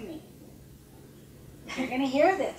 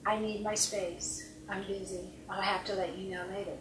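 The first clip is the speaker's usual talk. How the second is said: calm firm boundary; 3 semitones lower, 7 dB quieter than usual. Retold or imitated. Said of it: "I just had to say".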